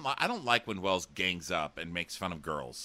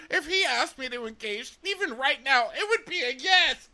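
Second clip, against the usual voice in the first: very high, loud mock squeal; Annalise; 6 dB louder than usual. Annalise says she'd accept a proposal, squealing mock eagerness. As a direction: rise to a shrieked, overexcited finish. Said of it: "And Annalise goes".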